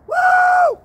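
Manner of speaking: high loud whoop. Real, not acted. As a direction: let out a big loud woo. Whooping in his story.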